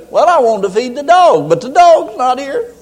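high-pitched plaintive excuse; mock helpless whine. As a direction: complain helplessly to your wife. Helpless man answers his wife.

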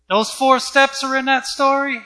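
high-pitched incredulous question; acted disbelief. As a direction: play astonished, pitch jumping up. Acting Hagin's surprised question to Jesus.

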